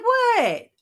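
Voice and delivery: high startled yelp of a mom caught out; surprised what. Retold or imitated. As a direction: squeak it out, caught off guard. Caught out by daughter, retold.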